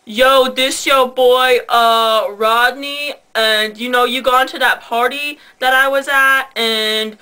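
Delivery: slow, drawn-out prank voicemail voice; Rodney. Retold or imitated. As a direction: imitate a guy leaving a halting voicemail, words stretched. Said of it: "leaving them a voicemail while they're at school".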